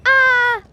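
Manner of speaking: excited squeal. Real, not acted. Squeal of hype after excited.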